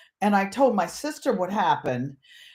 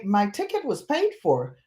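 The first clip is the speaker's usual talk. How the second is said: usual speaking voice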